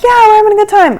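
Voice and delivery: high-pitched mock yell over noise; imitated screaming. Passengers answer the pilot over the noise, imitated screaming. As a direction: retell it as a high, excited holler. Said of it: "We're all screaming".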